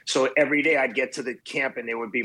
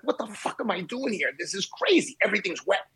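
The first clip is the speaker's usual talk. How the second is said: higher, near-tearful despair; half-played wail at the storm; almost crying; 6 semitones higher than usual. Stuck in a storm-soaked tent at night, near tears. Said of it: "I was almost crying".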